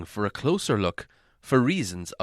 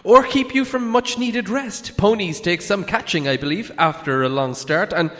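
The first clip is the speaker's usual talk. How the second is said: cheeky parting shot; 6 semitones higher, 5 dB louder than usual. A narrator's reading. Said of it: "he added as a parting shot".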